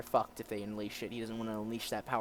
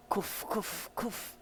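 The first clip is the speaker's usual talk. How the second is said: higher, put-on "kuf" coughs; spoken mock cough; 8 semitones higher, much slower than usual. Reader voicing a German's cough, mock coughing. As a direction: cough in words, short and separate. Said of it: "don't know how to cough German".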